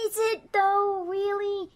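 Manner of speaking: high, doubtful wheedle; pleading doubt. Acted. Cecil doubting his partner's principle, high pleading. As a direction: gently question her in a high, drawn voice. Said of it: "trying to calm her down".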